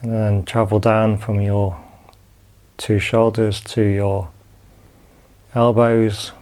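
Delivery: calm, unhurried body-scan guidance with long pauses; guided meditation. Acted. Teacher guiding students lying down through a body scan, calm meditation voice.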